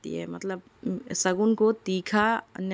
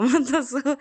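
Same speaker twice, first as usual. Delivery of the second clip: मत हंसो blurted through a laugh, pitched higher and louder; 7 semitones higher, 6 dB louder, slower than usual. Retold or imitated. Mother imitating the daughter's giggling, louder मत हंसो. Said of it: "मेरे साथ मस्ती करने लगी".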